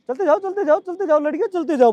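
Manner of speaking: high, eager calls to keep going; urging cheer. Real, not acted. Coach cheering the girls through the jumps, high urging calls.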